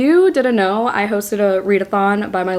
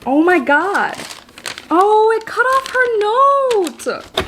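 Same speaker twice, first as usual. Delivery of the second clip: surprised; 11 semitones higher, slower than usual. Real, not acted. Surprised at note.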